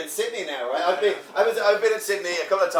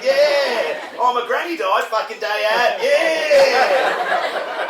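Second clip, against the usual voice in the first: loud, higher mock-Australian cheer; exaggerated chirpy Australian accent; upbeat Australian accent; 5 semitones higher, 6 dB louder, much slower than usual. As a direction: parody a relentlessly positive Australian. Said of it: "you sound positive no matter what's fucking happening".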